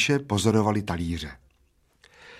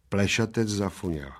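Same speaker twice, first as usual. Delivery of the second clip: ordinary delivery, nothing marked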